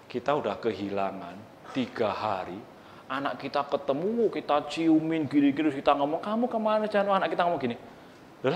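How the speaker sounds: softly voiced parent's complaint; quiet, half-confiding parent voice; quiet retelling. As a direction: talk it low and hushed, a hint of exasperation. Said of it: "saya tanya".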